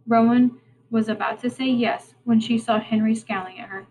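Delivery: plain reading voice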